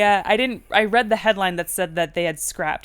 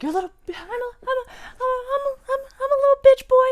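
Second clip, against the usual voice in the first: squeaky, rising to loud; high mocking whine; whiny high voice; 5 dB louder, much faster than usual. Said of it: "You're like".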